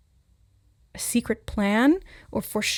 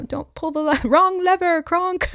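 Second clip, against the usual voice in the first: high, loud cartoon villainess cry; hammed-up animated movie quote, pitched way up; Yzma; 9 semitones higher, 6 dB louder than usual. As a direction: exclaim it high, breaking into laughter.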